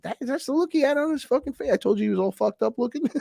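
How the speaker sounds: very high, squeaky outburst; excited vindication. Retold or imitated. Telling others about the guy's look, vindicated. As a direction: blurt it way up high, as proof.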